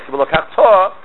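"Velokachta" with the final "ta" punched and held; stress on last syllable. Shown as a demonstration. Teacher demonstrating the command form, last syllable stressed. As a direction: land hard on the "ta" of "Velokachta". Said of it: "you stress the last syllable".

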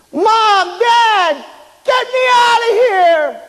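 high, loud drawn-out plea; desperate calling out. Retold or imitated. Boy under the sheet to his parents, desperate shout. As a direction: holler high for your parents.

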